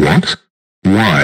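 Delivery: loud, indignant questioning. Acted. Biden learns she is getting inside his mouth, indignant. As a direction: demand an answer at full volume. Alarmed at second intruder.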